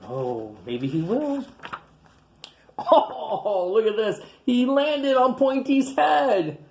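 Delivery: ordinary delivery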